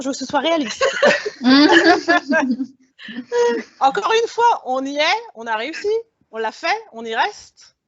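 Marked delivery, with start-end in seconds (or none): 0.9-2.7 short embarrassed laugh; a sheepish chuckle. Real, not acted. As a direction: laugh it off after apologising. Apologetic laugh after gloomy facts.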